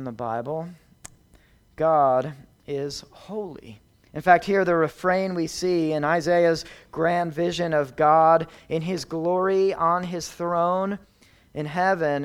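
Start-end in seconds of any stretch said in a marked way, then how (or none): none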